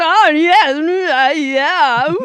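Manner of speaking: hammy British catchphrases. Retold or imitated. Mimicking many navy crewmen talking at once, in hammy British. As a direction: babble smug navy catchphrases over each other. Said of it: "catchphrase bits going on at once".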